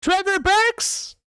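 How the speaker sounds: very high, loud, slow squeaky repeat; orc guard. Acted. Orc guard repeating the name slowly, high squeaky voice. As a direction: drag the name out suspiciously.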